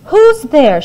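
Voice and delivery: high, loud call; giantess calling. A narrator's reading. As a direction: boom out who's there, peering. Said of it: "she said".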